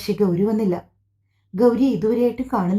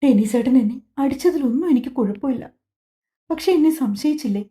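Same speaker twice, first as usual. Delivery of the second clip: higher, hurt voice on the edge of tears; lightly voiced sobbing complaint; a wife tearfully asking why her husband doubted her; tearful reproach; 4 semitones higher than usual. A narrator's reading. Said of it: "ഗൗരിക്ക് കരച്ചിലടക്കാൻ കഴിയുന്നില്ല".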